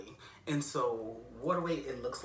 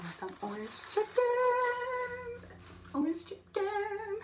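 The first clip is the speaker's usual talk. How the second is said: high sing-song voice; 5 dB louder, slower than usual. Pretend.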